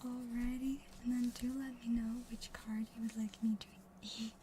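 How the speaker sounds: hushed ASMR card-opening narration; ASMR whisper. Real, not acted. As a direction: whisper gently, close and calm, leaving space between phrases. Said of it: "we're gonna go into A S M R".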